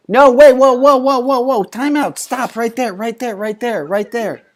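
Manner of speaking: high, loud whoa, stop right there; hammed-up panic halting him; loud high mock alarm. Comic alarm stopping Joe.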